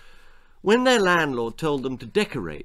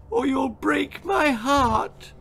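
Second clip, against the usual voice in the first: very high, slow trembling plea; frail old lady; 13 semitones higher, slower than usual. A narrator's reading. Nan pleading with Girl, high slow frail plea. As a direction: plead like a frail heartbroken gran. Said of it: "gasped the frail old lady".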